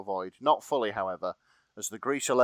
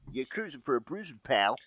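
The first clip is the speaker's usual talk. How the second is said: put-on quick tough-guy Brooklyn accent. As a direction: snap it out Brooklyn style. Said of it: "this accent".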